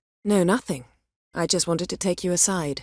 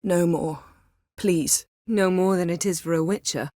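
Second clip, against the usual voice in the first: ordinary talk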